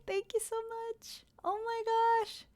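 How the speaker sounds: high, very soft, slowed thanks; touched gratitude. Real, not acted. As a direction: squeak out thanks, overwhelmed. Overwhelmed thanks for support.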